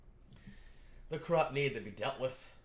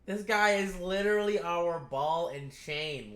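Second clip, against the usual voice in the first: louder, slow, emphatic complaint; exasperated rant; 5 semitones higher, 7 dB louder, slower than usual. Real, not acted. Viewer ranting about a useless character, loud and slow. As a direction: lean hard into the frustration.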